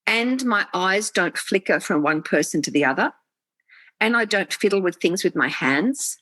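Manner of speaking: ordinary delivery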